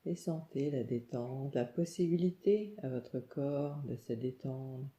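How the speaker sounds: ordinary talk, nothing marked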